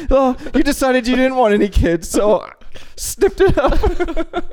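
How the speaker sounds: Michael Scott impression. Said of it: "He's like".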